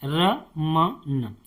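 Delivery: रमन drawn out syllable by syllable, very slowly; slow model word. Shown as a demonstration. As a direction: stretch out रमन for the class. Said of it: "अब वाले शब्दों को कैसे लिखेंगे?". (रमन को धीरे-धीरे खींचकर बोलना)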